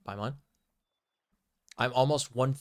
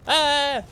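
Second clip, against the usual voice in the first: sudden high yelp of alarm; startled yelp; 14 semitones higher, 8 dB louder than usual. Real, not acted. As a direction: yelp as something lunges. Crocodile suddenly attacks.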